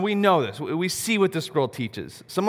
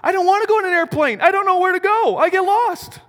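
much higher, louder, fast imitation of a tearful three-year-old; imitating crying toddler; 13 semitones higher, 7 dB louder than usual. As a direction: pitch up and rush it like a panicked little girl. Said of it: "And she started crying. She goes".

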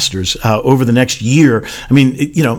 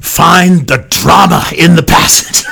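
imitating R C Sproul; 5 semitones higher than usual. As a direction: hand down the advice like an old teacher across the dinner table. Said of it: "classic Pittsburgh accent".

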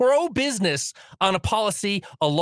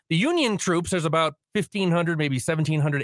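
ordinary talk, nothing marked

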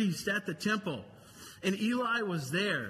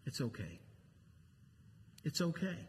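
soft reassurance; 4 semitones lower, 8 dB quieter, faster than usual. Real, not acted. Comforting the congregation.

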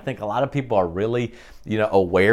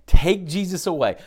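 mock crowd shout; 6 semitones higher, 4 dB louder than usual. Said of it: "they're crying out together".

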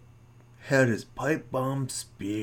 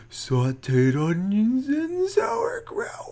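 voice shooting up high; 10 semitones higher, slower than usual. Acted. Voice shoots up again.

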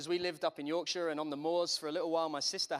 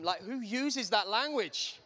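incredulous; 6 semitones higher, 4 dB louder, slower than usual. Real, not acted. Mocking churchy jargon.

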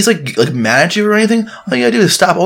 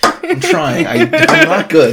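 laughing; 13 semitones higher, slower than usual. Real, not acted. Laughing at own slip.

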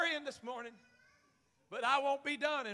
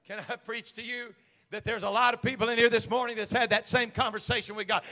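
ordinary talk, nothing marked

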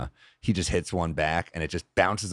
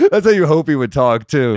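talking through a laugh, higher and louder; 6 semitones higher, 8 dB louder than usual. Real, not acted. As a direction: laugh it out in delight. Laughing at story.

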